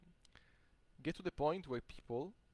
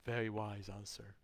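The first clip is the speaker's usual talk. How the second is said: slow, subdued awe; impressed wonder; 3 semitones lower than usual. Retold or imitated. Man impressed by his calm answer, slowly awed. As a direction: take it in, then speak slowly.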